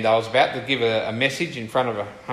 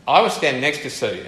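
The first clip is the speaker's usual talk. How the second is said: ordinary delivery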